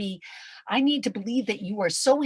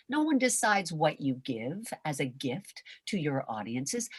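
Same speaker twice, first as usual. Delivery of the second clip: ordinary talk, nothing marked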